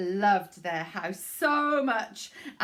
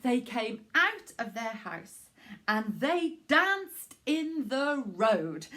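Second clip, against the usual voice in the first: ordinary delivery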